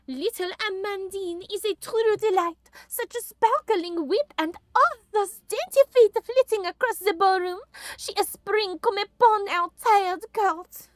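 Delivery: put-on high, lilting French-accented lady. Emperor's sister praising young Amandine, high French accent. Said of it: "I always thought that my French accent".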